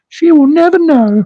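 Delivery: high falsetto, pushed a bit harder; playful high-pitched mock gloating; mock secretive glee. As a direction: repeat it in a cheeky high squeal. Repeats sneaky joke about wife.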